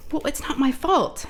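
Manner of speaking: high-pitched, drawn-out son's protest; imitated defensive protest. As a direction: mimic a teen protesting the blame. Son protests blame, retold.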